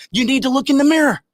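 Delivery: loud, high, furious order; angry. Real, not acted. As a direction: hammer it home in anger. Angry rant.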